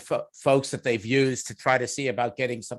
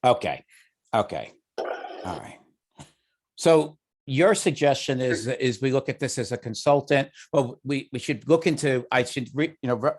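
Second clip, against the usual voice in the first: ordinary talk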